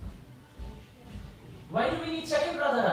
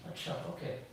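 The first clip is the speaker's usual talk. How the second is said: very soft, lower acknowledgement; hushed obedient reply; 5 semitones lower, 12 dB quieter than usual. Acted. Pupil answering the one prompting him, hushed compliance. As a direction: take the cue under your breath.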